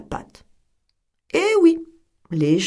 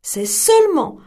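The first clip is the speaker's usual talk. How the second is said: louder, higher alarmed telling; distressed narration; 7 semitones higher, 6 dB louder than usual. A narrator's reading. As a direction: tell the wrecked house with alarm. Mother finds wrecked house.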